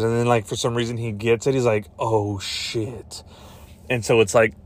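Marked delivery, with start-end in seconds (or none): 2.0-3.2 soft, under-the-breath movie reaction; hushed mock realization. Hero finally gets the dinosaur threat, hushed mock realization. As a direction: let it sink in quietly.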